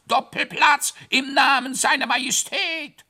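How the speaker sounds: high, loud bellowed command; roaring shout. Acted. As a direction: holler like a herald running. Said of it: "brüllte er dabei in einem fort".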